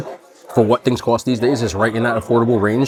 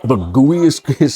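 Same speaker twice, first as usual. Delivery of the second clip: laughing; 4 semitones higher, 4 dB louder, slower than usual. Real, not acted. Excited over melted cookie.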